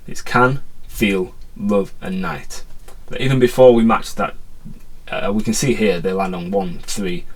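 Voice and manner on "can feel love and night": "can", "feel", "love", "night" each given its own weight as a separate word; stressed words listed. Shown as a demonstration. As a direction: pick out each stressed syllable one by one. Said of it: "where the emphasis naturally lies".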